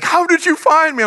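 put-on high amazed little boy; child voice. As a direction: play the surprised son. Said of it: "and he's like".

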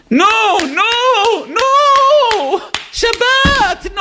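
very high, loud, slowly stretched nagging; frantic hurry. Acted. Stressed father rushing his family before Shabbat, frantic high yell. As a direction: screech at them to hurry up.

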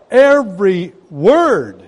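"Every word" slow and loud, both words punched; emphatic preaching. Real, not acted. Preacher driving a verse home to the congregation, slow and emphatic. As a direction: hit "Every word" hard, drawing each word out.

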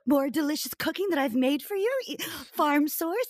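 imitating a hopeful mom, high and eager; hopeful offer. Mother offering her cooking to daughter, hopeful. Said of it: "My hope. She saw the hope".

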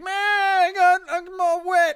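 high, loud mock yelp; a hammed-up cry of being drenched; mock shout soaked. TikToker pours water over himself, hammed-up loud yelp.

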